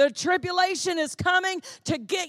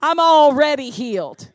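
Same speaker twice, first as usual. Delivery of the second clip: louder, hitting "already" hard; emphatic declaration; 8 dB louder than usual. Real, not acted. Preacher declaring to the congregation, loud and sure. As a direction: proclaim "already" with full weight. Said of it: "repeats it with force".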